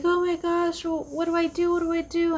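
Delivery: high, frantic, pleading; mock panic over a breakup; panicked friend. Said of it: "And she's like".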